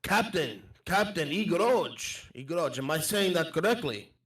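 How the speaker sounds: polite foreigner with careful lilt; accented traveller. Acted. Addressing the pirate captain, polite accented traveller. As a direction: ask courteously with a foreign accent.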